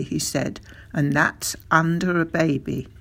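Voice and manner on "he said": usual speaking voice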